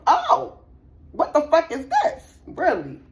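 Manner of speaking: a high, loud gasp at the phone; shocked disbelief. Acted. Reader acting out waking to a shocking text, high loud shock. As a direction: act out finding something awful on the phone.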